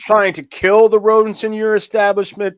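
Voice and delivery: stern, disbelieving reporter questioning; incredulous reporter. Acted. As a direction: grill him like a skeptical reporter. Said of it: "You sounded so incredulous".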